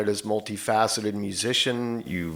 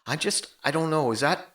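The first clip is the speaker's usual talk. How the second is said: unsure Ellen; 8 semitones higher than usual. Retold or imitated. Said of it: "she called me back ... she said".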